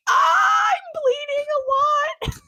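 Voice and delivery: very high, slow half-laughing whine; mock wail. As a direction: wail it in comic distress over a paper cut. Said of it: "And I was like".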